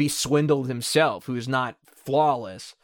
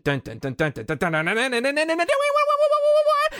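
rising sung guitar notes, last one held high; vocal guitar solo; 12 semitones higher than usual. Retold or imitated. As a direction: sing the solo climbing up high. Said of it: "it's just the dun".

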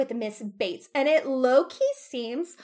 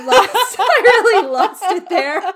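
loud laugh through the words; real laughter while admitting it; 7 semitones higher, 9 dB louder than usual. Real, not acted. Laughing at own messy recap.

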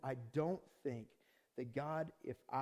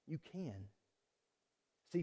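ordinary talk

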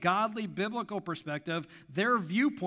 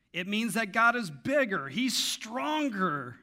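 emphatic proclamation; 5 semitones higher, 7 dB louder, slower than usual. Real, not acted. Preacher proclaiming God's strength.

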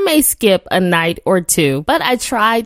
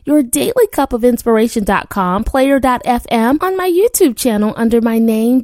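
ordinary talk, nothing marked

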